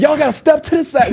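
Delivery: high voice ordering people aside; imitating the usher clearing a path. Usher lady clears the crowd for them, high and commanding. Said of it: "She tell people".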